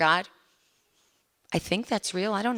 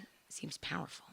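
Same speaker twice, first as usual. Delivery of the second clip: very soft echo of wonder; retold awed remark, barely voiced; hushed awe; 18 dB quieter than usual. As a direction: let it drop almost to a whisper. Repeats the onlookers' awed words.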